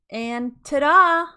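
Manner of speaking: loud, high, drawn-out showman's ta-da; big theatrical reveal; ta-da fanfare. A narrator's reading. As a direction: present it like a magic trick. Grand reveal of turkey.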